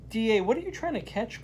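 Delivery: put-on a quick curious kid's question; a hint of a schoolboy asking; young boy's question. A narrator's reading. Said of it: "Carlos asks".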